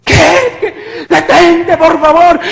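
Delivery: high, loud pleading cries; acted terrified plea; desperate begging. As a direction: beg in panic for it to stop. Begging the attacker to stop.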